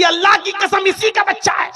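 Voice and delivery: high, loud shouted oath. Acted. Woman shouting her oath at the driver. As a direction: swear it at the top of your voice.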